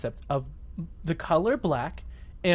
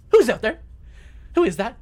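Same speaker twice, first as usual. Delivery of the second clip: loud, higher-pitched imitation of a jealous girlfriend; mock jealous demand; 6 semitones higher, 5 dB louder, faster than usual. Girlfriend confronting him over the phone, mock jealous demand.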